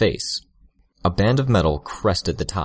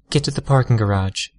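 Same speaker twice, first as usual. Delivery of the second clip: put-on urgent girlish order; 5 semitones higher than usual. A narrator's reading. Tattletale orders escape.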